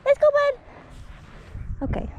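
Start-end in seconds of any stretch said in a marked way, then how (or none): 0.0-0.5 high, loud coaxing call to a dog; squeaky praising a dog. Real, not acted. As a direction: sing out to the dog. Calling dog.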